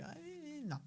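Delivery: high, very soft scandalized "Ay, no"; mock shocked gasp of the critics; mock gossip. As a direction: mimic the critics clutching their pearls. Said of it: "para que no anden diciendo".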